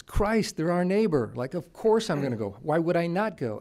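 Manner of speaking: put-on loud, high, emphatic Boston accent. Army general explaining why he visited Mexico, in Boston accent. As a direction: belt it out like a blunt Boston general. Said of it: "He's got this great Boston accent".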